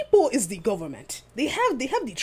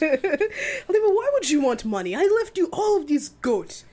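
put-on African accent; 7 semitones higher than usual. Voicing the dead grandma.

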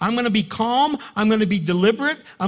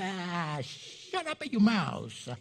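soft, slow dismissive line with a laugh; laughing retort; 8 dB quieter, much slower than usual. Retold or imitated. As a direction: brush it off through a chuckle.

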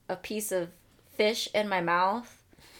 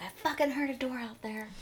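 dog voice; 5 semitones higher, 5 dB quieter than usual. Retold or imitated. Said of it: "He's gonna be like".

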